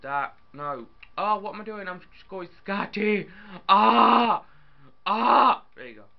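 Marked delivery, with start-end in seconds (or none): 3.7-5.6 short loud high scream; sudden startled shriek. Real, not acted. Gamer screaming at nothing, loud high scream. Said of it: "I don't know why I'm screaming".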